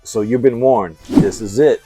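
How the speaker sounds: usual speaking voice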